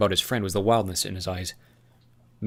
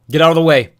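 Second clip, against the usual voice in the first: shouted warning; 8 semitones higher, 15 dB louder than usual. Acted.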